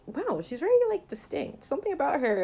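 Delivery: high-pitched mock awe; impressed wonder. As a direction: act out dawning fascination, voice lifted high. Acted dawning fascination with actress.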